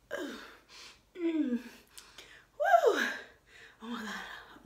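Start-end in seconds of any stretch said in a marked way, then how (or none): 1.5-3.0 high yelp after the sip; disgusted whoop. Real, not acted. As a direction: shake off the taste with a yelp.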